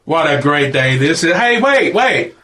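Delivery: high, loud eager call; high excited hey wait. Acted. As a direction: call her back in delight. Space Baby excited calling.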